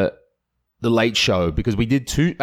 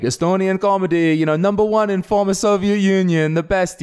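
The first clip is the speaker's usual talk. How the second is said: high-pitched, hammed-up street pitch; over-the-top hawking; 11 semitones higher than usual. Flyering strangers for comedy show, over the top. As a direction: sell it like a mock barker. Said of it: "go over the top".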